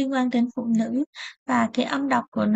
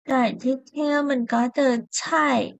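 usual speaking voice

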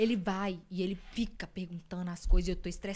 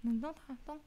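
imitated placating husband; 5 dB louder, faster than usual. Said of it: "Não fica com raiva". (marido manhoso, acalmando baixinho)

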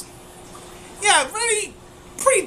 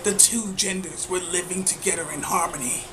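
lightly voiced dramatic trailer narration; a hint of an epic movie narrator; epic fantasy narrator; 5 semitones lower, 4 dB quieter than usual. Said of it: "I could do a better job".

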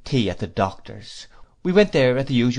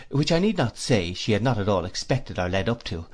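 plain reading voice

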